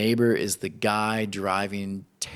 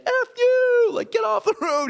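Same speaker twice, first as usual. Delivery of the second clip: road rage shout; 6 dB louder than usual. Retold or imitated. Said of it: "He's like".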